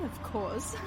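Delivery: normal talking voice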